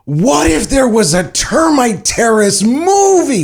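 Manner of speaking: high, slow, dreamy wide-eyed voice; imitating his younger self's big idea, high and drawn out; mock naive teen. Imitating their teenage selves at lunch, in a high, slow dreamy voice.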